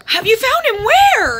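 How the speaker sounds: high, loud rush of disbelief; real joy breaking through at the news; urgent, thrilled questions; excited. Real, not acted. Cat suddenly found after days missing.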